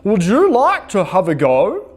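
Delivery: big friendly moose offers trumpet; hammed-up moose voice. A narrator's reading. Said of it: "he said".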